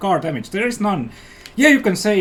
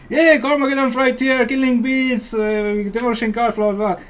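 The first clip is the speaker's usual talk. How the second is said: hyped presenter; 6 semitones higher than usual. Retold or imitated. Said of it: "there's Sam, like".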